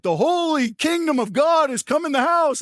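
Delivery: lightly voiced enthusiastic believer; exuberant friend.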